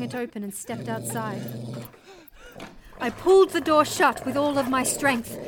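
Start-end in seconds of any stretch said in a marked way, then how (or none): 3.0-5.4 raised, loud, straining narration; intense narration. A narrator's reading. Mother shutting dingoes in the shack, loud intense telling. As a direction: tell it at the height of the fight.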